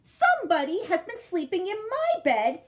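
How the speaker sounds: high, loud cub squeak, slowing at the end; Baby Bear finds the girl in her bed, squeaking. A narrator's reading. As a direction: squeak it like a small excited cub. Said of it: "and squeaked".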